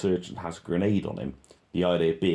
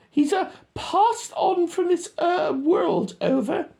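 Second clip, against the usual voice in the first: much higher, louder mock radio talk; squeaky radio voice; 5 dB louder, slower than usual. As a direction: mimic a soldier on the radio, squeaky.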